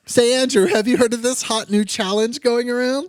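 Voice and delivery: cheesy commercial host pitch; ad read. Acted. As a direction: hype up the sponsor segment. Sponsor read intro.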